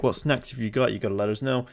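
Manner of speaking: normal talking voice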